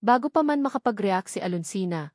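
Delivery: ordinary delivery, nothing marked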